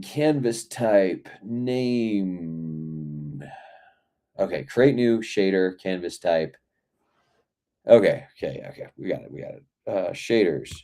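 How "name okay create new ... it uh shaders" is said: ordinary talk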